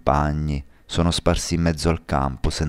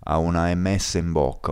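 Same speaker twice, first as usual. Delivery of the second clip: ordinary delivery, nothing marked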